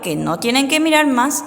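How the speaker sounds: usual speaking voice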